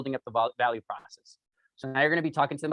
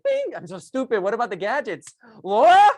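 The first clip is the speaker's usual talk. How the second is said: high, louder call ending in a shout of Laura; excited realization; 8 semitones higher, 8 dB louder than usual. Retold or imitated. Salesman calling back customer Laura, excitedly. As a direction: blurt it out as an idea hits. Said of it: "I was like".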